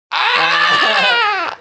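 long, high-pitched "aah"; high scream. Pretend. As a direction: let out a sustained high scream. Outburst while stalling before next comment.

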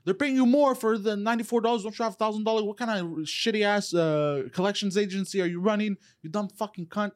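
retold rant. Caller ranting at the collection agent, angry yelling. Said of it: "And I just went off".

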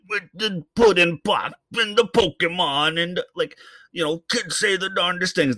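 a deliberately terrible Cosby impersonation; bad Bill Cosby impression. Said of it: "That's probably the worst Bill Cosby you ever heard".

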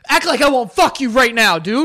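loud mock tough guy. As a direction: bark it like a bro. Tough guy threat, acted.

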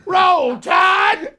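high, loud laughing Bama yell; hype cheer. Retold or imitated. Rick storming in during prayer, yelling Roll Tide. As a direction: cheer like a football fan. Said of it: "and I come".

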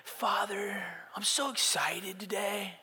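high, airy mock prayer voice; fake pious prayer. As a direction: pray with syrupy put-on sweetness. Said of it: "we pray".

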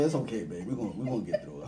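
soft, low, fast comforting; reassuring. Retold or imitated. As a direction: soothe her quietly. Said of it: "I'm like".

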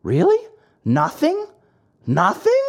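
high rising disbelief; disbelieving. Acted. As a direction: ask it like a shocked child. Pinocchio's disbelief.

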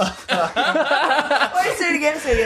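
laughing. Real, not acted. Laughing, asks for repeat.

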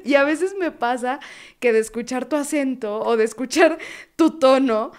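normal talking voice

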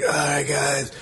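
imitating a groaning rock frontman. Mimicking a singer talking to the crowd, lightly voiced frontman. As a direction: drag it out like a worn-out rock singer. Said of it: "[name] would talk, be like".

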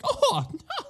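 high squeaky mock denials fading away; prim protest. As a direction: play the prude squealing no. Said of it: "and she'd be like".

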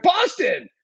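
high-pitched mock shout from the audience; retold yell. Friend yells her hometown to the comedian, imitated high shout. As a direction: call it out like an eager crowd member. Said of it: "somebody yell out".

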